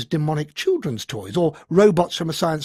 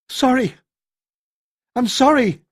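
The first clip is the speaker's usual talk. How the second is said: higher, loud apology; desperate shout; 8 semitones higher, 5 dB louder than usual. A narrator's reading. Keith facing the weapon, desperate shout. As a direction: shout it helplessly. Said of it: "all he could do was shout".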